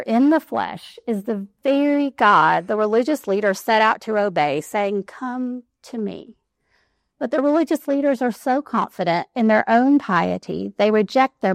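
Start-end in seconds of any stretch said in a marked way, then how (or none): 5.0-6.4 slow, soft and tender, come to me; softly spoken invitation, much quieter and slower; gentle invitation. Acted. Jesus' invitation voiced tenderly.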